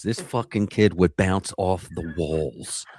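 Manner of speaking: ordinary delivery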